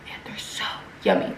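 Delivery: hushed, breathy delight; whispered yummy. Real, not acted. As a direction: whisper it like a secret treat. Savoring favorite soup.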